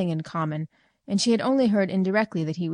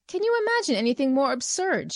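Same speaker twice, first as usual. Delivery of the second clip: higher, mocking outcry; cried out; 7 semitones higher than usual. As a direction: cry it out in scornful disbelief. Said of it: "she cried to a friend".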